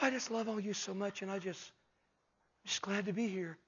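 hushed, meek mock testimony; soft-spoken imitation. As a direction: mimic a gentle man murmuring into the mic. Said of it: "Some real soft-spoken guys".

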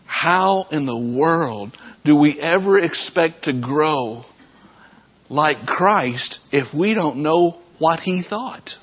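usual speaking voice

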